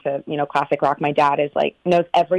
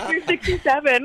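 laughing through the line, much higher; laughing quote; 11 semitones higher than usual. Retold or imitated. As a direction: laugh out the age at him. Brother teasing dad, laughing.

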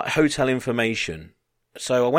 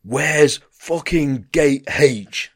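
loud, slow bellow, pitched up; dead loud demand; 5 semitones higher, 5 dB louder, much slower than usual. Retold or imitated. English bloke at the airport information desk, dead loud. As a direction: imitate a rude bloke bellowing slowly. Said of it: "Dead loud".

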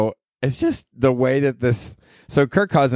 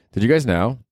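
ordinary talk

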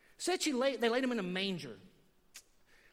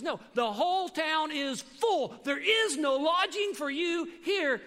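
slow, higher, emphatic retold refusal; louder, drawn-out mock pronouncement; 5 semitones higher, 5 dB louder, slower than usual. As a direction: spell it out slowly as the clerk turning a guest away. Clerk emphatically refusing him a room.

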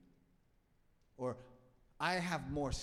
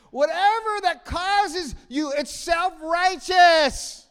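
much higher, louder, very slow; preaching shout; 21 dB louder, slower than usual. Acted. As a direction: shout the verdict to the back row. Preaching climax.

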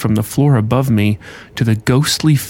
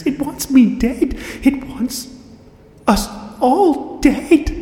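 high, slow, stammering whisper; frantic warning; 14 semitones higher, 4 dB quieter, slower than usual. Acted. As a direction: warn in breathless terror. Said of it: "frantically issued the rest of her warning".

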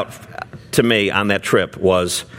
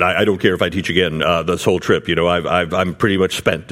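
lower, faster, fed-up imitation of the tired preacher; weary complaint; 5 semitones lower than usual. Doctor Young telling the team he is spent, low weary grumble. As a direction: grumble like a man who has had enough. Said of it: "he said".

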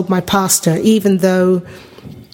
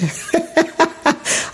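laugh; 9 semitones higher, 5 dB quieter than usual. Real, not acted. Laughs at her own impression.

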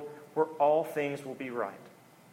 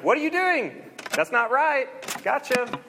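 excited outburst; 8 semitones higher than usual. Retold or imitated. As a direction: catch the cheat in glee. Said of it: "he looks at the guy and is like".

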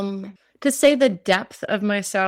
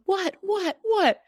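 high, startled repeated what; a mother suddenly alarmed, much higher; alarmed mom; 11 semitones higher, slower than usual. Retold or imitated. Mom startled by her daughter's sobbing, high and alarmed.

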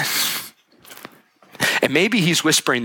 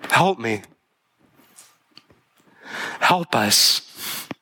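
imagined desperate prayer; 2 semitones lower than usual. Retold or imitated. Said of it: "looked at God and said".

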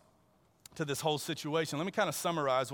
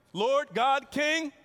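mock grandiose; 8 semitones higher, 5 dB louder, much slower than usual. Mocks an overblown title the man uses.

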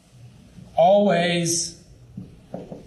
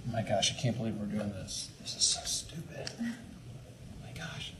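softer mock complaining whisper; whiny whisper; 9 semitones lower, 14 dB quieter than usual. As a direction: mutter the complaint under your breath. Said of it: "so tempted to not be positive".

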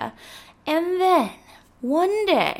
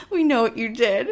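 laughing. Real, not acted. Laughing during the quote.

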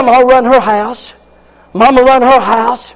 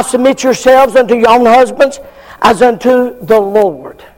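normal talking voice

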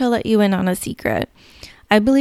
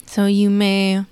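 guided meditation. Acted. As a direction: lull them gently into calm.

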